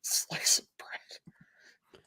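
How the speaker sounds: whispered slow aside; whispering. Real, not acted. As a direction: breathe it out slowly as a joke. Repeating the joke under breath.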